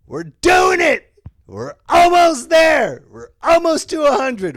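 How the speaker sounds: high, loud, slow cheering; high hyped celebration of episode eighty; excited celebration. Acted. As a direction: cheer the milestone.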